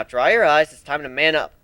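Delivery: plain reading voice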